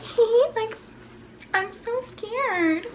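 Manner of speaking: high, slow, soft cutesy giggle; coy giggle, milk it; innocent and cute. Retold or imitated. To the boy she likes, cutesy fake fear. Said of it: "trying to act all innocent and cute".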